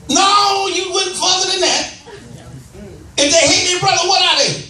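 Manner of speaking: fiery pulpit preaching, pitched high. Acted. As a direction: drive the scripture home to the congregation. Pastor preaching at full force.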